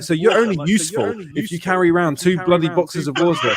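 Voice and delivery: shouted mock outrage at the film; exasperated rant. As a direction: bellow it in disbelief. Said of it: "I'm like".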